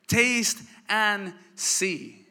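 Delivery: very slow, drawn-out "Taste and see"; savouring the words. Acted. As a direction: let each word linger. Preacher repeats the verse.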